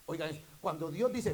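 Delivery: loud, high, fervent preaching; preaching at full force. Acted. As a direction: build it up and drive it home to the congregation. (predicación a todo pulmón, fervorosa y fuerte)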